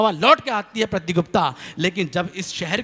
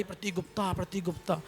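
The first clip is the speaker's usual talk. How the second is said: much softer echo of the name; 13 dB quieter than usual. Retold or imitated. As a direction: let it come back faint and distant. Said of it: "आवाज़ आई".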